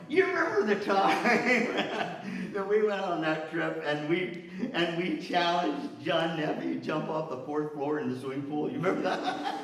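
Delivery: put-on high, giggling recall of an old prank; laughing reminiscing. Old bandmates at the reunion, giggling. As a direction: laugh through the memory with old friends.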